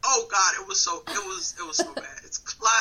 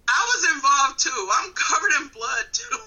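higher, louder mock self-defence; retold indignant protest; 8 semitones higher, 5 dB louder than usual. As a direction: protest your innocence, pitched up and loud. Retold protest to angry partner.

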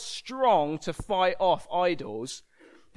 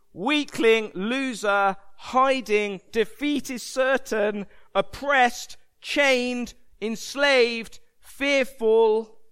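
loud, raised, punched single words; screaming accusations; 5 semitones higher, 6 dB louder, much slower than usual. Acted. The story screaming labels over cowering Gideon, loud accusations. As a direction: hurl each label separately, loud and high. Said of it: "screaming over Gideon".

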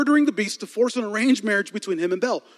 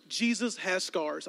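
slow, soft repeat of Jesus has scars; hushed; 9 dB quieter, slower than usual. Shown as a demonstration. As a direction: say it softly, letting it sink in.

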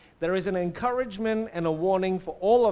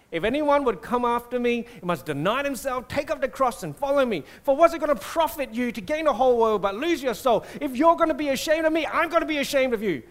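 stern, touchy scolding that builds higher and louder; disapproving; 4 semitones higher, 3 dB louder than usual. Acted. Jesus reading the disciples the riot act, disapproving. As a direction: tell them off, quickening toward the end. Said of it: "disapproving tone".

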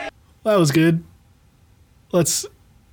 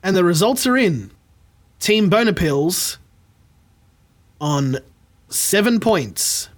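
dramatic slow reveal. Acted. Reveal of the quiz winners.

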